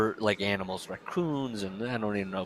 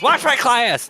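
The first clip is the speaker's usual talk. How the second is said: high, loud shouted order; hammed-up panicked teacher calling out; mock frantic yell; 13 semitones higher, 9 dB louder than usual. Imitating teacher fleeing the room.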